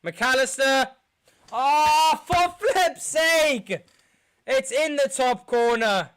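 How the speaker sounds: loud pained cry at the miss; anguished shout. Real, not acted. Fan watching his team lose, loud groaning cry. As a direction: yell it out as the penalty flies in.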